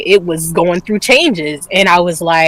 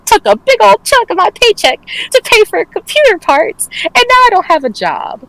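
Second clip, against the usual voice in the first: much higher, frantic disbelief; high panicked whine; 13 semitones higher than usual. Retold or imitated. Retelling to friends how losing the job felt, much higher panicked whine. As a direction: wail it like your world just collapsed.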